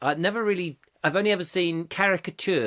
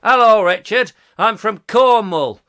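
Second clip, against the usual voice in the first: loud, higher-pitched mock Cornish; Cornish accent; 4 semitones higher, 9 dB louder than usual. Shown as a demonstration. Demonstrating accents to Richard, loud Cornish accent. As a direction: announce yourself in a hammy Cornish accent. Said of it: "Here's Cornish accent".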